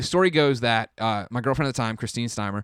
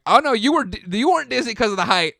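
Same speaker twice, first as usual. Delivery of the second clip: panicked; 11 semitones higher, 6 dB louder than usual. Retold or imitated. Panicked inner voice.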